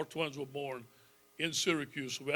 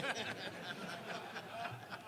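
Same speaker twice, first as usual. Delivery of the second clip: soft laugh; 2 semitones higher, 14 dB quieter than usual. Real, not acted. Laughing at own joke.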